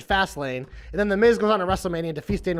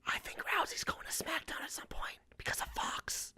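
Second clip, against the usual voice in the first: secretive hushed whisper; 8 semitones higher, 18 dB quieter than usual. Real, not acted. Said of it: "Why are you whispering?".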